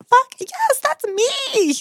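very high, loud squeal of delight; excited yes. Retold or imitated. Sugar baby jumping at an offer, excited high squeal. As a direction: sing out an elated, drawn-high yes. Acting out her eager reaction.

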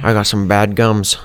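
ordinary delivery

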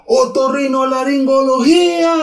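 drawn-out shout, pitched high and slow; shouted into the canyon. Retold or imitated. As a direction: yell the long word into the canyon. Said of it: "y grita". (grito largo y agudo hacia el cañón)

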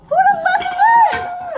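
very high squawked retort; startled outrage. Retold or imitated. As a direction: retell it as an indignant high-pitched squeal. Retold angry wake-up to banging.

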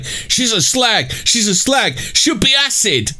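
high, slow mock shriek; hammed-up screeching of a jealous woman; mock hysterical rant. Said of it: "she went on to say".

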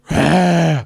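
jump-scare roar, imitated; mock monster roar. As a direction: burst out with a pouncing roar. Imitates accomplice jumping out.

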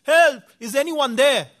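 high-pitched, loud calling for help; retold shout for help. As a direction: shout up for rescue, acting it out. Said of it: "Again he's shouting".

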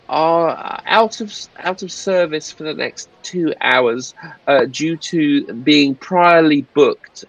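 high, halting official announcer voice; megaphone announcement. Acted. Philpott tells passengers the courts are booked, apologetic announcement. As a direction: announce it apologetically, stretching the words. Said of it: "he holds up the megaphone".

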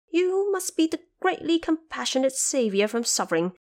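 high, lively trapped monkey king, grateful; eager Monkey King. A narrator's reading. Trapped Monkey King thanks the visiting Bodhisattva, high and eager.

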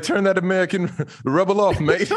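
mock British accent, cockney mate; hammed-up London accent. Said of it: "That was terrible".

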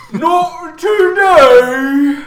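very slow, high, loud troll shout; dopey cartoon bellow. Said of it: "the troll says".

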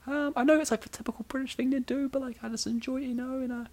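very high falsetto British character. Acted. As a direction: play a London lady in a high put-on British voice. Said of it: "it's either British or Mickey Mouse".

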